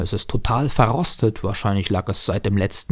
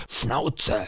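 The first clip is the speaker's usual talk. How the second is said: ordinary delivery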